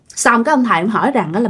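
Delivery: usual speaking voice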